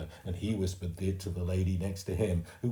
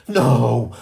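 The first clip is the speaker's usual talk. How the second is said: much louder shouted no; 5 semitones higher, 14 dB louder than usual. A narrator's reading. Mum shouting at Fudge, loud narrated no. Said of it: "Mum shouted".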